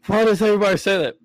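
high, exasperated outburst; laughing protest. Real, not acted. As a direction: protest loudly at a high pitch, amused. Mock protest amid shared laughter.

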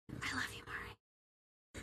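soft unvoiced whisper, I love you; whispered aside. Real, not acted. Whispering a fond aside to Mari. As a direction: breathe it to Mari.

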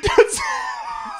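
high laughing, slow shout; laughing plea. Real, not acted. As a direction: plead while cracking up. Begging through laughter.

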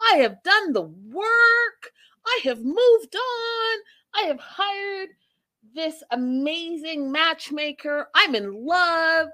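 high, slow, drawn-out hurt insistence; overacted wounded declaration; mock upset Darcy. Darcy lecturing Stacy about healing, high and slow. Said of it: "And Darcy's like".

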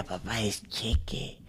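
a soft, patient fix of the name; mock correction. Son correcting dad on the singer's nickname, soft and slow. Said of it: "yo le decía".

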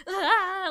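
a high, sharp frightened "ah"; startled yelp. Retold or imitated. Nurse cornered by a yelling man at the pump, high startled yelp. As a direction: yelp like you just got cornered. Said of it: "and I'm like".

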